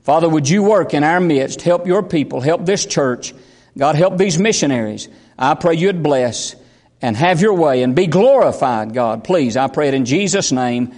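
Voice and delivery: low-pitched, pleading public prayer; earnest prayer. Real, not acted. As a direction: pray low, asking God to help. Closes an opening prayer.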